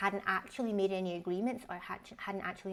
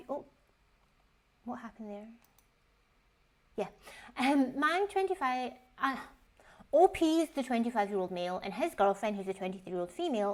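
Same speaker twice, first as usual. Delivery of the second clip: ordinary talk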